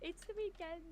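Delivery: quiet nudge to keep walking; hushed aside. Acted.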